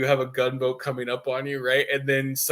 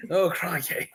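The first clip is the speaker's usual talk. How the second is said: higher mock-Australian voice with a laugh; put-on Aussie exclamation; 6 semitones higher than usual. Echoing a friend's suggested line, amused Aussie accent. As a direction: play along with a hammed Aussie accent. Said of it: "You have to say".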